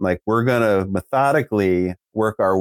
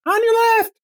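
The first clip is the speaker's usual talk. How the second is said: tiny squeaky high voice; mouse voice. Retold or imitated. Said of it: "mouse voice".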